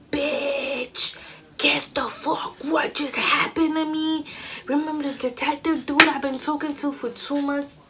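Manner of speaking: put-on girl, you won't believe this; excited gossip. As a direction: spill the news to your best friend. Calling homegirl with big news.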